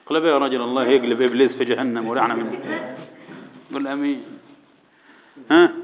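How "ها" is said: usual speaking voice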